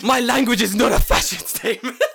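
sung through laughter, high and loud; giggling mock protest chant; laughing sing-song. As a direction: belt the slogan while cracking up.

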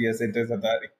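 ordinary delivery

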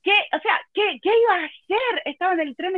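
high, breathless panic; panicked high. Real, not acted. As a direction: sound genuinely alarmed. Reliving panic.

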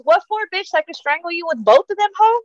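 high, sassy pretend threat; mock threat. Voicing threat to Barbara.

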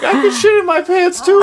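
high, pushy mock girl voice; Asuka. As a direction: butt in high, eager to compete. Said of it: "Asuka comes in and she's like".

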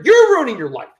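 "you're" punched high and loud; emphatic high, falling "you're"; stress on you're. Real, not acted. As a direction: hit "you're" hard to turn the blame round. Contrast with others blamed before.

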